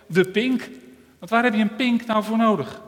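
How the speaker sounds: high, loud sneering taunt; mean mocking. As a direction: jeer at the little finger. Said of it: "met een gemeen lachje". (hoog en luid gemeen spottend)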